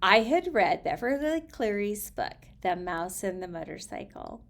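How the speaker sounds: expressive storybook reading voice; reading aloud. Acted. Reading a children's author's letter to her audience, expressive storybook voice. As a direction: read the letter to listeners, lively.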